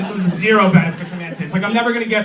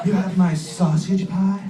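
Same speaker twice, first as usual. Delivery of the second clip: lightly voiced porno mom; hot mom. Hot mom greets pizza driver, lightly voiced seduction. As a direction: put on a hint of seductive mom. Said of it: "there's that hot mom who's like".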